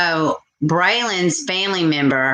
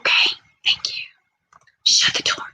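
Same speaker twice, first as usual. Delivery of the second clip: real whisper. Real, not acted. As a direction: hush it so the stream barely hears. Hushing child off camera.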